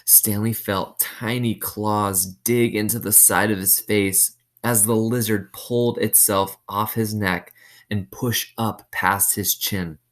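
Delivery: ordinary delivery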